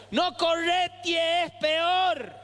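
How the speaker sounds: put-on high, drawn-out old lady's call; old neighborhood women. Old women sweeping warn fleeing boy, high stretched-out cry. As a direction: wail a warning like an old neighbor. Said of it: "las viejas uñudas esas que estaban barriendo".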